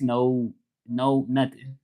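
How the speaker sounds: normal talking voice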